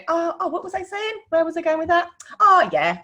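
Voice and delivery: high, scatterbrained "where was I" voice; mock flustered forgetting. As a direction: play yourself losing the thread, lightly comic. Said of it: "and I'd go".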